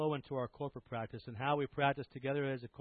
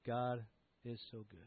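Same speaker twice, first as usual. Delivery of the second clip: slow breath prayer; 2 semitones lower, 8 dB quieter than usual. Shown as a demonstration. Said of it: "Practice that together".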